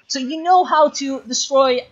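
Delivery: exasperated high plea, slowed right down; exasperated scolding. Real, not acted. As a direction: plead with the player in disbelief, drawn out. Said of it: "I'm mad at you right now".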